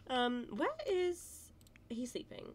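normal talking voice

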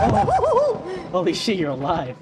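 high, loud laughing shock. Real, not acted. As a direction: shout through a laugh. Surprised friend alive.

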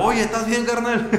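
mock jaguar buddy. Jaguar voiced helping another.

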